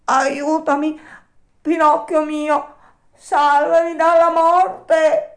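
high, loud, slow begging for life; heartrending cries. A narrator's reading. Alidoro begging Pinocchio to save him, high, loud and desperate. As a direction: plead like you're drowning. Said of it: "A quelle grida strazianti".

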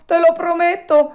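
high, loud promise rushed out; hurried promise. A narrator's reading. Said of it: "A quelle grida strazianti".

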